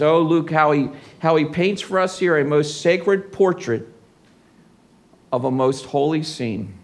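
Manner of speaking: usual speaking voice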